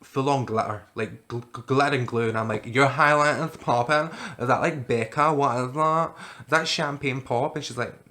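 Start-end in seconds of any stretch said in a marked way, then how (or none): 2.6-7.5 put-on loud, drawn-out drunk voice; drunken gushing. Drunk girl admiring a stranger's glitter highlighter, drunken gushing. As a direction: gush like you're hammered, loud and slow. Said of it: "I was that drunk".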